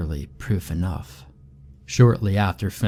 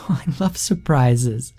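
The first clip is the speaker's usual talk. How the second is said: put-on giggling girl; 5 semitones higher than usual. A narrator's reading. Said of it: "she giggles".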